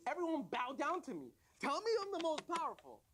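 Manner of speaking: high-pitched, hammed-up power trip; gloating command. Tongue ordering the body parts to bow, gloating command.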